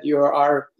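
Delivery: ordinary delivery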